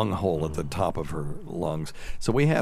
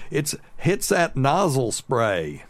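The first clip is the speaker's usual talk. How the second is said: higher, slow, drawn-out insisting; insistent mother-in-law; 6 semitones higher, slower than usual. Retold or imitated. Mother-in-law at the pharmacy window, slow and insistent. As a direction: repeat it like it's obvious. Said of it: "she keeps going".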